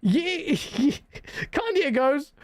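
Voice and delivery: ordinary talk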